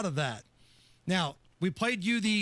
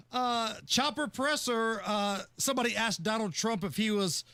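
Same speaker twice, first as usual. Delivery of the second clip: ordinary delivery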